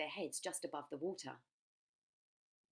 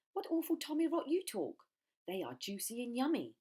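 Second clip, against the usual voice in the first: much higher, louder scoffing; scornful crocodile; 8 semitones higher, 6 dB louder than usual. Acted. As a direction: scoff at the nonsense. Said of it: "cried the enormous crocodile".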